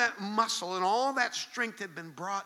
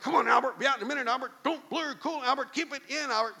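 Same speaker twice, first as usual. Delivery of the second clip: higher, louder, rushed pleading; frantic coaxing; 6 semitones higher, 5 dB louder, faster than usual. Retold or imitated. Dad zooming through the store, pleading with his screaming son, higher and louder. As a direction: keep the words calm but sound panicked. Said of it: "now the dad's like".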